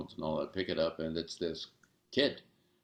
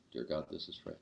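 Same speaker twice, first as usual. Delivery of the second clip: softer repeat of "Dear God, this is Fred."; hushed repeat of the prayer line; quiet echo; 3 semitones lower, 7 dB quieter than usual. Retold or imitated. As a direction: echo it back softly, like the man repeating after him.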